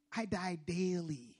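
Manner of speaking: "I die daily" drawn out slowly; slow emphasis. Shown as a demonstration. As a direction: let each word of "I die daily" sink in. Repeats Paul's words slowly for emphasis.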